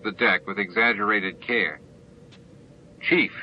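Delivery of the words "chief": sharp, higher stern call; sternest voice. A narrator's reading. Sisko summoning the chief from the panel, sternly. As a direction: call him out like a commanding officer. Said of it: "in his sternest voice".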